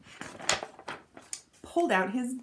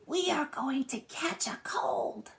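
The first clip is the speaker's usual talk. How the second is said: secretive whisper, slow; soft whisper; 8 semitones higher than usual. A narrator's reading. Little Raccoon whispering his plan to Red Squirrel, softly. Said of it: "He whispered softly".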